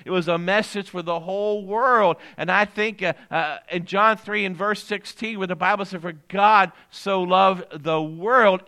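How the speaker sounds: loud, weighty preacher's cadence; full-force preaching. Real, not acted. Pastor proclaiming a message for the whole world, preaching. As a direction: drive each phrase home to the congregation, loudly.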